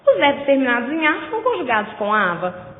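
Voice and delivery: ordinary talk, nothing marked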